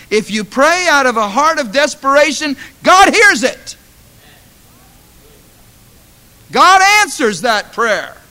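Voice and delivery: loud, high climactic preaching; preaching at full force. Acted. Pastor exhorting the church, loud fervent preaching. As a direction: drive it home to the congregation.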